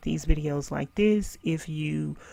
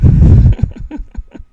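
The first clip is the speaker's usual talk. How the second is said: loud, breathy laugh; 16 dB louder than usual. Real, not acted. Laughs after yawning.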